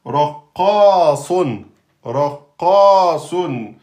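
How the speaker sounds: "raqasun" very slow and loud, heavy r and q; loud slow model reading; slow demo word. Shown as a demonstration. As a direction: spell out "raqasun" slowly. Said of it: "on a donc".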